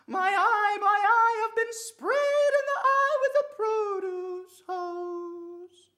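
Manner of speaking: very high, very loud drawn-out wail; screaming old woman. Acted. Old woman sprayed in the eye, hammed-up screaming. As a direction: scream it hammed-up, stretching the last words. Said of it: "she yells out screaming".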